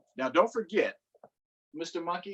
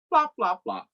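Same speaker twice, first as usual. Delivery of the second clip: high flop sound words; 9 semitones higher than usual. A narrator's reading.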